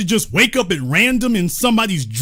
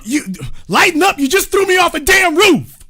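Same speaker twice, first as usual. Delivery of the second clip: indignant yell; 7 semitones higher than usual. Acted. Thrown worker yelling back at the foreman, outraged. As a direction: shout it back like you just got thrown off a roof.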